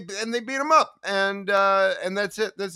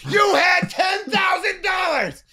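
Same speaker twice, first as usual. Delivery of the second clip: much higher, louder, slow outraged yell; mock furious shout; 7 dB louder, much slower than usual. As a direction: yell it out the window at the departing computer. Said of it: "shouted after it".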